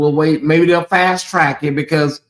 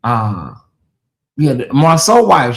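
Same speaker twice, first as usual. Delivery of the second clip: normal talking voice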